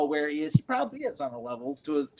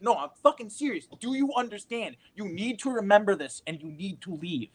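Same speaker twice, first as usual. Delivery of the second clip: a hint of an urgent older actor, higher; urgent warning; 7 semitones higher than usual. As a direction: press the warning home, lightly voiced. Mentor insisting he's serious.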